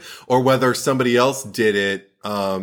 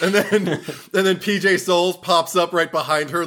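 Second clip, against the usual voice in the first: usual speaking voice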